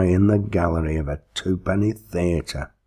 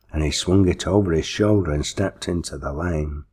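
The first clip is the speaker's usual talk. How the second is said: plain reading voice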